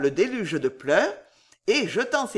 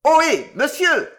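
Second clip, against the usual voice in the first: much louder, higher yell; howling hail; 8 semitones higher, 9 dB louder than usual. A narrator's reading. Sam halting the stranger as he steps on, howling. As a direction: bellow to stop someone walking off. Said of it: "un nouveau hurlement de Sam".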